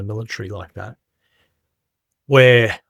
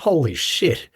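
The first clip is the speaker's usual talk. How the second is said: higher, retold disbelief; stunned; 5 semitones higher, slower than usual. Speaker recalls the old fella seeing through him, stunned. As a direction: relive being floored by what was just said.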